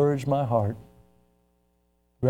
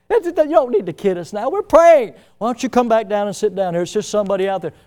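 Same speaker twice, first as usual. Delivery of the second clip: mock dismissive; 6 semitones higher, 6 dB louder than usual. Parents dismiss damsel's news.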